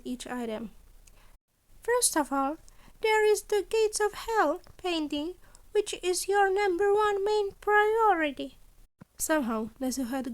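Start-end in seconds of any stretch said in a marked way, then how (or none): 1.8-8.6 higher, brighter little principal voice; a scheming principal, a touch higher and louder; Nezu's voice. A narrator's reading. Principal Nezu explaining his deal, higher and louder.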